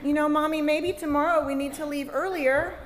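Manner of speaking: hint of a worried kid's pointed suggestion; lightly voiced anxious oldest child. Oldest child to mom about running late, lightly voiced and pointed.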